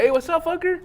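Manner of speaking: high, playful friendly greeting; a joking hello to a friend. Shown as a demonstration. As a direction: tease a buddy, grinning. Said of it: "But it's like".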